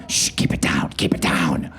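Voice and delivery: whispered shushing; breathy mock shush; hushing whisper. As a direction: hush your partner urgently. Partner hushing during sex.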